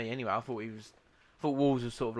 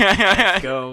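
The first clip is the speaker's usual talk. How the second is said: loud, higher hype shout in a put-on American accent; mock American hype; 7 semitones higher, 18 dB louder than usual. Mocking the American player's post-match hype, loud American shout. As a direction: yell it like a pumped-up American player. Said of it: "the player was like".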